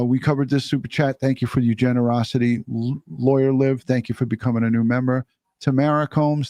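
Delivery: usual speaking voice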